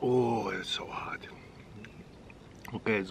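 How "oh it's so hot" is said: slow, soft whisper through the burn; real breathy whisper of suffering; pained whisper. Real, not acted. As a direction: breathe it out, worn down by the heat. Overcome by the chip's burn.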